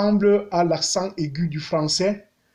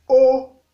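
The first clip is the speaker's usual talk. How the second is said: Haut held level, a step below the high one; mid tone; 6 semitones higher, 6 dB louder than usual. Shown as a demonstration. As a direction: say Haut evenly, just under the top. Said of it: "Ça donne ceci". (« Haut » au ton moyen)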